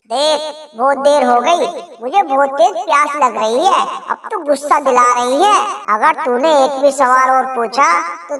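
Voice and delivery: husband demanding water from wife, impatient. Acted. Husband losing patience.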